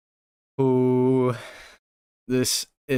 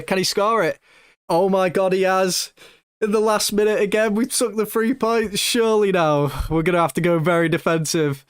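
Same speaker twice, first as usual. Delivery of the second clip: goal celebration; 7 semitones higher than usual. Real, not acted. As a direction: shout the late winner in real delight. Last-minute winning goal scored.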